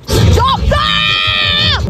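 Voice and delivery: real shouted stop; sharp shout. Real, not acted. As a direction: yell at her to stop. Yelling at friend.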